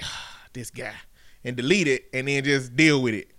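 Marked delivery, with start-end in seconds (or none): 0.0-0.9 muttered. Retold or imitated. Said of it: "be like".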